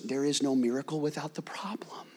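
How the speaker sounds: whispered, soft, intimate; hushed whisper. Acted. Preacher confiding in the congregation, whispered. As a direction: lean in and whisper the truth.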